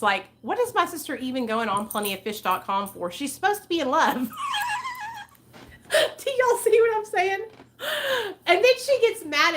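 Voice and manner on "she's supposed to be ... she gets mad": high-pitched, breaking into laughter; laughing in disbelief. Real, not acted. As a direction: crack up while making the point. Amused at sister's dating hypocrisy.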